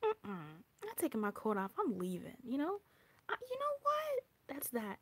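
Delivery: high, mock-fed-up cry of you know what. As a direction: throw up your hands and storm off. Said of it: "You're like".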